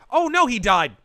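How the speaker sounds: high loud mock dismay; excited exclamation. Real, not acted. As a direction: cry out as it dies. Lion falls in pit.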